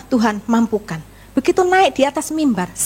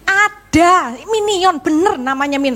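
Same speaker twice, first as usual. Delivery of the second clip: higher-pitched, louder, mock-stubborn insistence; insistent retort; 5 semitones higher, 3 dB louder, slower than usual. Wife arguing restaurant's name with doubtful husband, insistent. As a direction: play up being absolutely sure.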